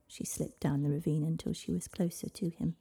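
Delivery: ordinary delivery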